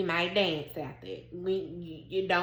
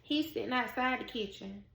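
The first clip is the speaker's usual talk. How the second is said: usual speaking voice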